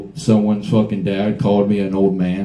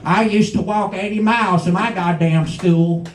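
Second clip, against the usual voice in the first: mock old-timer; 8 semitones higher than usual. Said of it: "Everyone's like".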